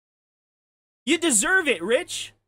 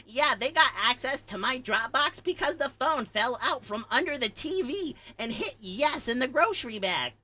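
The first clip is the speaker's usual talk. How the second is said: mocking quote. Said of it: "You have to be fucking stupid to say".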